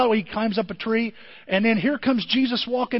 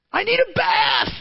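high, loud sinner's protest; 9 semitones higher, 4 dB louder than usual. Retold or imitated.

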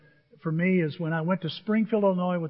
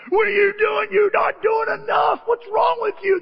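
high, full-force shouting; acted angry hologram yell; yelling accuser; 7 dB louder than usual. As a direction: berate the listener at the top of your voice. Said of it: "they're all yelling".

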